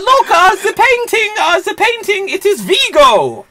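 very high, frantic mock voice; high, over-the-top villain's sidekick; hammed-up film henchman. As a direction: squeal it in melodramatic alarm. Acts character shrieking about painting.

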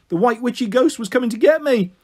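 high, loud alarm in the telling; panicked. A narrator's reading. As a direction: tell it as a rising fright. Frightened narrator fears a ghost.

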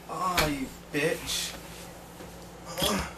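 usual speaking voice

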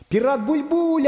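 high, drawn-out joyful cry; overjoyed, voice way up; delighted exclamation. Acted. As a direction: greet the name with high-pitched delight. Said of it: "обрадовался Карандаш".

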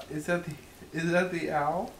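normal talking voice

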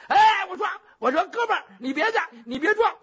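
high, loud, frantic pleading; frightened jailer begging the prisoner to stop; panicked plea. Acted. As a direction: grab him and beg in a panic. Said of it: "一把把程咬金就抱住了".

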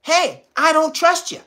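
high, put-on cop voice; mock cop voice. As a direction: play the suspicious officer, high and pushy. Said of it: "they come up to you and they say".

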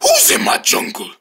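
a menacing creature asking who intrudes; gruff bear voice. Acted. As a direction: growl it like a big territorial beast. Bear voice claims the jungle.

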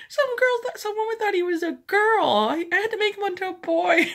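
high, loud laughing excuse; laughing defensive husband. Retold or imitated. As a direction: justify it through laughter. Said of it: "he's like".

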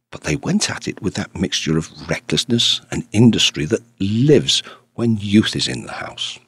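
usual speaking voice

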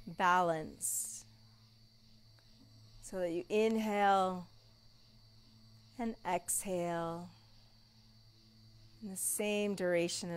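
soft, slow guided meditation voice; soft spa whisper. Acted. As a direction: lull them into stillness. Guided relaxation in Shavasana.

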